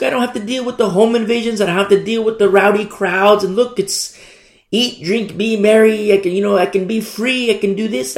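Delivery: sing-song mockery of easy freedom; mock carefree believer. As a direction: play the smug believer who just wants comfort. Preacher voices carefree Corinthian believer.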